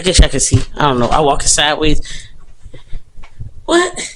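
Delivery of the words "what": sudden high-pitched what; short surprised yelp of a question; startled what. Real, not acted. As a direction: jump up in pitch, caught off guard.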